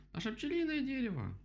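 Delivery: very high-pitched horrified cry; horrified yell. A narrator's reading. Rabbit yells about the bee tree, horrified. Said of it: "в ужасе заорал кролик".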